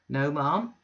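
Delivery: a loud, bluff self-made man; blustering Bounderby. Acted. Bounderby booming an invitation to Mrs Sparsit. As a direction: boom the invitation like a braggart host.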